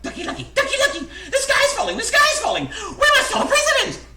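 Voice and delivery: very high, loud squawking alarm; clucking panicked hen. A narrator's reading. Chicken Licken running in circles after something hit her, frantic high clucking. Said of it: "and clucked".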